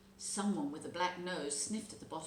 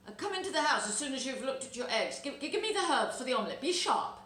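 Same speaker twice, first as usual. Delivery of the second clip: sharp, hurried and loud, higher; abrupt; 7 semitones higher, 6 dB louder, faster than usual. Acted. Fox rushes Jemima inside, abrupt and impatient. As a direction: snap the orders fast and loud. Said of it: "He was rather abrupt".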